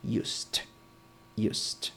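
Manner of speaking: "used" ending in a T, said softly; soft teaching demo, "used" pronounced like "yoost"; final T sound. Shown as a demonstration. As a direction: demonstrate "used" slowly with the final D as a T. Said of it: "lo pronuncias como una T al final".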